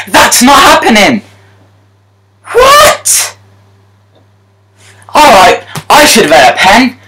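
high, loud raging yells; furious player shouting at EA. Real, not acted. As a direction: scream at the game in fury. Furious at late loss.

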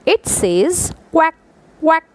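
high playful quack quack; duck quack imitation. Shown as a demonstration. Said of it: "I am giving you one hint".

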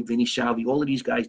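ordinary talk, nothing marked

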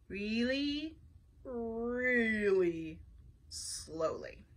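each word stretched long, sinking low; very slow. A narrator's reading. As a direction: drag it out to mimic slowness. Describes the sloth pushing slowly.

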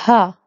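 Ha said loud with a strong breathy h; loud demo of Ha with a clear h. Shown as a demonstration. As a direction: breathe the h out fully in Ha. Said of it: "Il faut bien le prononcer, le he".

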